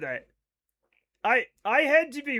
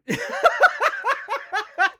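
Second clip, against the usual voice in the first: high helpless laugh; burst of laughter; 6 dB louder than usual. Real, not acted. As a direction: crack up at chat.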